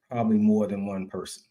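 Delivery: ordinary talk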